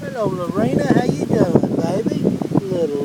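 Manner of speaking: high cooing pet talk. Real, not acted. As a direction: coo sweetly to the little puppy. Baby talk to a puppy.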